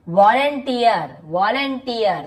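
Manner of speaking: वॉलंटियर slowly, ending ti-yar; drawn-out ईयर ending. Shown as a demonstration. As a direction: sound out वॉलंटियर at half speed. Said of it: "यहाँ पर भी आपने ईयर". (वॉलंटियर धीरे, ईयर खींचकर)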